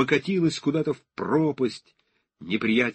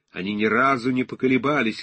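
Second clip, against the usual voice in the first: plain reading voice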